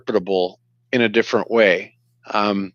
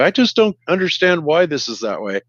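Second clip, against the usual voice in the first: higher, playfully puzzled tone; mock confusion; 6 semitones higher than usual. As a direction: act like you don't get it, tongue in cheek. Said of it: "we kinda go".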